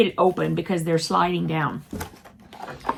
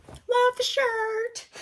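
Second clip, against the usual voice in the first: high, slow, sing-song gush; 14 semitones higher, 5 dB quieter, much slower than usual. Real, not acted. Admiring the new purple t-shirt, high sing-song.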